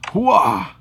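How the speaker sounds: sharp high battle cry; barked hooah. Pretend. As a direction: bark it out like a soldier. Shouting at racing opponents.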